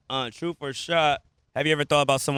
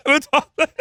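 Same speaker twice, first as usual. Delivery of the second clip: laughing; 11 semitones higher, 5 dB louder than usual. Real, not acted. Hanging up on caller.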